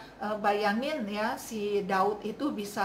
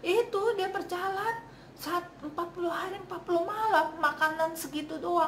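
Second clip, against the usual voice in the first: high-pitched, incredulous recap; amazed emphasis; 7 semitones higher than usual. Real, not acted. Preacher stressing forty days on so little food, amazed. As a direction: lift the pitch in disbelief.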